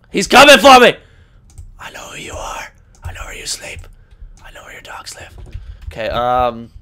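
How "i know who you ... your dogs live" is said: menacing hushed whisper; creepy whisper. Acted. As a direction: whisper it like a stalker. Creepy threat to opponent.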